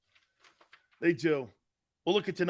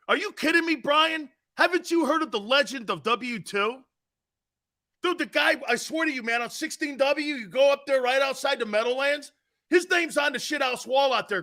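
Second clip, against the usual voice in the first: high-pitched, hyped-up mock amazement; mock disbelief; 7 semitones higher than usual. Host swears to Brian about W two's legend, in mock disbelief. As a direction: pitch it up, selling the tall tale.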